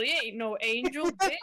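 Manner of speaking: loud, sassy mock southern accent; teasing southern-accent call-out; weird southern accent. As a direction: put on a playful southern accent. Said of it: "doing this weird southern accent".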